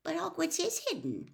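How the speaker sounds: in surprise. Acted. Hermione surprised at Ron, high startled voice. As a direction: sound amazed he doesn't know. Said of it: "said Hermione in surprise".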